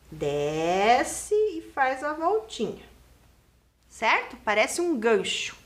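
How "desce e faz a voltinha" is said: slow, with Desce stretched out as the stroke is drawn; slow narration timed to a pen stroke; tracing along. Shown as a demonstration. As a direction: draw out DEEESCE, then ease through the little loop. Teacher tracing the letter stroke.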